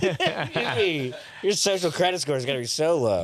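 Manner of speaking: laughing through the words. Pretend. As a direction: giggle while mocking. Laughing mock announcement.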